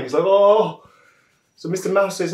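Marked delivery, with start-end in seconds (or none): 0.3-0.8 high, loud pained moan; mock crying. Powerful elephant crying in the bushes, hammed-up wail. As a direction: wail like a storybook elephant in tears. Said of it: "he's crying".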